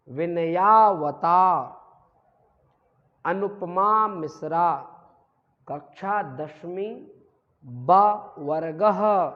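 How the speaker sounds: "विनयावता" loud and drawn out; "अनुपमा मिश्रा कक्षा दसवीं" slow, word by word; repeat-after-me dictation. Shown as a demonstration. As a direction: dictate loudly in pieces, ending "वर्गः" on "ha". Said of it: "इसको पूरा repeat करिए".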